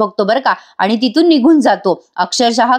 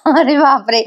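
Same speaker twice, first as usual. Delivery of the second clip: a laugh, then a slow, higher oh my god; 4 semitones higher than usual. Real, not acted. Narrator laughing at the shocking twist to viewers, amused disbelief.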